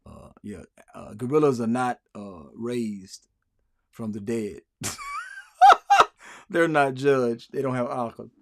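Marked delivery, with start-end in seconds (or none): 4.8-6.1 sudden squealing laugh; cracking up at his own joke; high burst of laughter. Real, not acted. To his viewers, laughing at his gorilla joke with a high squeal.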